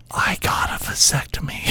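breathy whisper into her ear. Pretend. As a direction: whisper it like a secret. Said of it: "Could you imagine somebody whispering".

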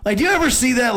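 usual speaking voice

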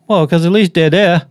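thick imitated Boston accent on "they're there". Guest quoting his uncle's reason for going, Boston accent. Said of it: "example of a Boston accent".

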